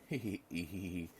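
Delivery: acted hee-hee chuckle; laugh. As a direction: chuckle along in character. Character laughs in story dialogue.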